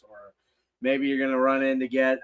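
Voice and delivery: ordinary talk, nothing marked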